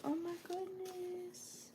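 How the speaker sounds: soft, slow and breathless with awe; awed whisper. Real, not acted. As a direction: let the words barely come out, overwhelmed. Awed by old family photo.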